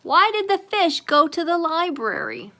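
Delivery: put-on joking kid. Kid telling a joke.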